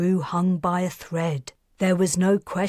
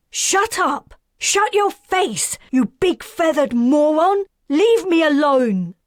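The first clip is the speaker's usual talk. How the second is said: much higher and louder, yelling; tearful shout; 10 semitones higher, 6 dB louder than usual. Acted. Karis screams at Perseus to leave her alone. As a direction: scream him away through tears.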